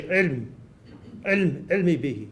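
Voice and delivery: haltingly demonstrated, swallowed sounds; words drawn inward, with a pause between tries. Shown as a demonstration. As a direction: go into yourself to say it. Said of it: "into yourself to say".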